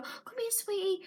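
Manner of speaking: high, soft cooing voice; cooing at a dog. Retold or imitated. Stranger cooing sweetly at a service dog, high and soft. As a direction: coo it like calling a pet over.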